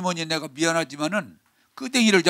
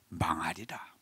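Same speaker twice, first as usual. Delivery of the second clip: much lower and softer, heavy with doom; low grave pronouncement; 10 semitones lower, 13 dB quieter, slower than usual. Acted. Preacher pronouncing judgment on the age, low and grave. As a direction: drop the voice to a solemn verdict.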